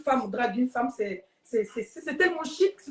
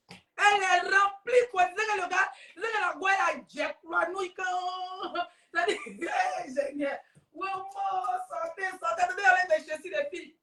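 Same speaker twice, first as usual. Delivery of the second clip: exaggerated swooning; 6 semitones higher than usual. A man gawping at her Instagram, mock-excited gushing. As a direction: stretch the words out high, as if overcome.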